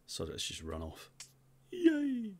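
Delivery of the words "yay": excited cheer. Real, not acted. Celebrating in game.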